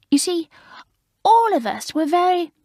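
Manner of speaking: high, prim, gushing schoolgirl voice; prim new girl. Acted. Maureen, alarmed, telling the fifth formers off, high and prim. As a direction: play the prim girl shocked at the idea.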